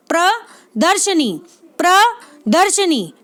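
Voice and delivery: प्रदर्शनी slowly split, प्र, then दर्शनी with a short a after sh; correct pronunciation. Shown as a demonstration. Teacher giving students the right form, slow and split. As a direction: say प्रदर्शनी in two slow pieces, sha-nee at the end. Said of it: "क्या होता है".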